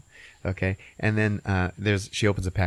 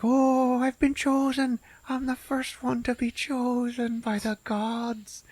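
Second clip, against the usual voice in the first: high, slow, reverent squeak; tiny cartoon potato, awestruck; awed potato voice; slower than usual. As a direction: squeak it like a chosen little potato in awe. Potato voiced in retold movie.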